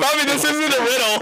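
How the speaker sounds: laughing while talking. Real, not acted. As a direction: giggle through the words. Laughing at Bobby.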